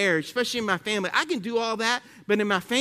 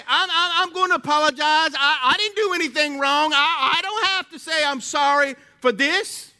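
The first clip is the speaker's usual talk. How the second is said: higher, louder indignant self-defence; a prideful spouse protesting, higher and louder; proud refusal; 7 semitones higher, 4 dB louder than usual. Retold or imitated. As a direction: play the stubborn one who won't say sorry. Voicing proud person refusing apology.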